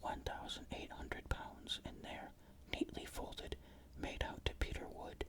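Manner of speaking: ordinary delivery, nothing marked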